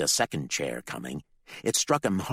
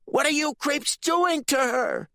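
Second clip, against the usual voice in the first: much higher, louder furious yell; outraged yell; 5 dB louder than usual. Acted. Ricky yells at the cultists, outraged. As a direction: scream it in outrage. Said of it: "His question became an outraged yell".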